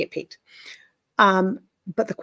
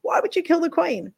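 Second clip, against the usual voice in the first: high, shocked mock outrage; imitating people freaking out over the dead queen; freaking-out animal lover; 10 semitones higher than usual. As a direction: squeal it like a horrified animal lover. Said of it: "everybody's freaking out going".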